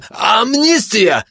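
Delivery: high, drawn-out cry; pleading high-pitched outcry. Acted. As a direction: yell it high, stretching the word. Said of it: "закричал предатель".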